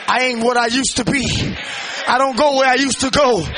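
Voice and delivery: loud, high preaching with a shouted build; full-force preaching. Real, not acted. Preacher urging the church not to back down, shouting. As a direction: drive the congregation, don't let them back down.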